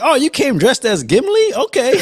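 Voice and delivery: high-pitched mock surprise; teasing impression. Teasing Marco about walking out of the theater, high mock surprise. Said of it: "you walked out there like".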